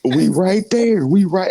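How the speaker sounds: high, slow, drawn-out mock wail. Joking to co-hosts about Frank retiring, high drawn-out mock wail. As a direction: ham up the heartbreak, stretching each word. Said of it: "I'm saying that as a joke".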